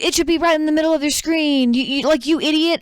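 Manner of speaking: high, fast, fed-up insistence; mock a caller losing all patience; imitated exasperated scammer. Scammer loses patience in retelling.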